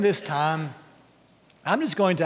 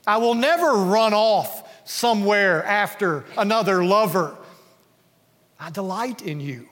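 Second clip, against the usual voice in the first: earnest vow; 5 semitones higher, 5 dB louder than usual. Acted. Believer's vow to Jesus, voiced.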